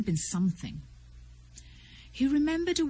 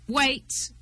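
calling a halt, a touch raised; mild cry; 5 semitones higher, 3 dB louder than usual. A narrator's reading. Strange reining in his horse, a mild cry. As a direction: lightly cry out, higher. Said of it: "cried Strange".

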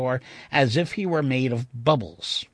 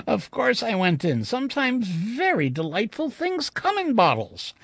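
usual speaking voice

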